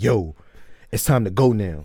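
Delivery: loud, fired-up retelling of a hyped dad; imitating a dad getting amped; savage hype. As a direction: pump them up like a savage. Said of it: "then he just turned into the savage".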